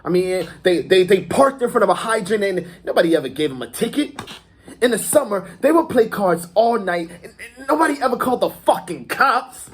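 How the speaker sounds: high, animated gangster storytelling; excited boasting. Acted. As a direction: marvel out loud, pitch climbing. Acted gangster monologue, marvelling at privilege.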